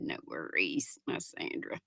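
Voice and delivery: unvoiced, very soft quote; whisper. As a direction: breathe the quote secretly. She said.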